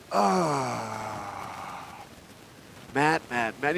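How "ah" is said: low drawn-out mother's sigh; disappointed sigh. Retold or imitated. Mom hears her son's career plan, long low sigh. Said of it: "she goes".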